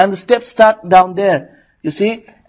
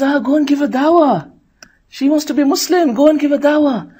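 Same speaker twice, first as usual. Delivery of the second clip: egging-on shaitan voice; 8 semitones higher than usual. Retold or imitated. Shaitan urging dawah to girl.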